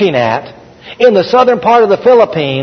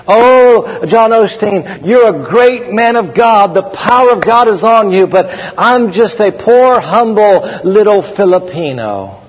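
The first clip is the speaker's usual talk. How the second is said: slow, higher-pitched reverent gushing; humble Filipino translator; 4 semitones higher, slower than usual. Retold or imitated. Translator praising John Osteen and belittling himself, humble imitation. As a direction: draw it out in humble, hammed-up admiration. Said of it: "he would always say to John Osteen".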